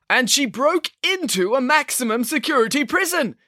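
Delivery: high, emphatic public accusation; accusing Mister Green. A narrator's reading. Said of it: "concluded Mister Green".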